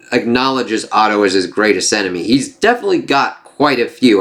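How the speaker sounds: ordinary delivery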